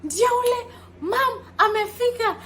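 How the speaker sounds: high, loud excited shout of a name. Retold or imitated. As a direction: call out across the airport to your sister. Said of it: "she was literally shouting".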